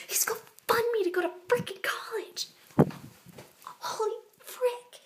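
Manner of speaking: bursts out loud and high with real excitement; can't hold it in, shout it out high; excited outburst. Real, not acted. Orphan girl learning a patron will fund her college, excited loud outburst. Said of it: "I'm trying to keep it very calm".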